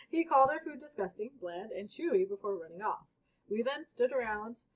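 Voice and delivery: usual speaking voice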